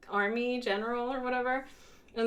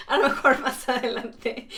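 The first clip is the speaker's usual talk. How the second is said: laughing; 3 semitones higher, 4 dB louder than usual. Real, not acted. Laughing at own comment.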